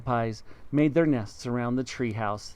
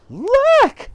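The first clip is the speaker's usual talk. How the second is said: very high, much louder shriek; wife screaming at the floor above; 11 dB louder than usual. Acted. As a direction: shriek it at the top of her lungs. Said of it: "screamed Miss Twit".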